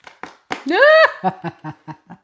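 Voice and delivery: sudden loud real laugh; loud burst of laughter. Real, not acted. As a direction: let a big laugh burst out at the chat. Laughing at a chat comment.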